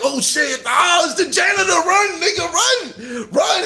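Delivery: high, hammed-up alarm cries; mock panic. People fleeing the killer janitor, comically panicked. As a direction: yelp a warning as if fleeing a monster.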